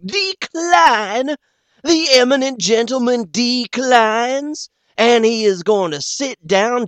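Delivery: high, loud, slow teasing; mocking. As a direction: ham it up for the crowd. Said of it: "playing with Billy ... on the joke".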